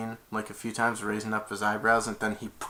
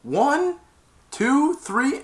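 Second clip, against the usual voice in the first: loud, high, slow ultimatum; hammed-up menacing yell; threatening countdown; 13 semitones higher, 10 dB louder, slower than usual. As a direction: count down like a bully. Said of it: "And he went".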